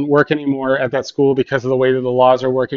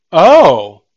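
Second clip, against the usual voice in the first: loud, swooping "oh" of discovery; sudden realization; 10 dB louder than usual. Retold or imitated. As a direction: imitate a child's lightbulb moment. Child suddenly understands money link.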